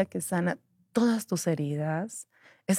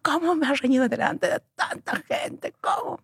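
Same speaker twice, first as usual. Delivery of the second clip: high hurt protest; indignant; 8 semitones higher than usual. Retold or imitated. Young Erika complaining about being scolded, higher.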